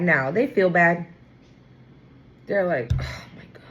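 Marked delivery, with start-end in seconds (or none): 2.9-3.6 soft breathy whisper of regret; whispered dismay. Retold or imitated. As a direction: breathe it out, ashamed. Person feels bad, breathed.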